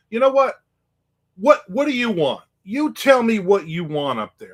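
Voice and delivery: high, loud, fed up; exasperated outburst. Retold or imitated. Big guy fed up with the pest, loud and exasperated. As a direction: blurt it out, out of patience.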